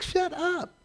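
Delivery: put-on high, soft little-kid parroting; toddler copying. Little daughter copying dad's TV retort, high and soft. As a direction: play the baby echoing dad. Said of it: "she's like".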